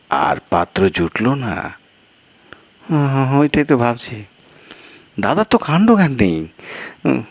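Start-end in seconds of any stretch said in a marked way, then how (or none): 2.9-7.2 a second character pitched higher, exasperated; higher-voiced guest. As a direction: play the flustered guest, voice raised in pitch.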